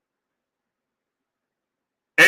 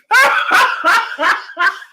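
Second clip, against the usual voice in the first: laughing fit. Real, not acted. Cracking up at Tank's media day answer, laughing fit. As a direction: crack up helplessly.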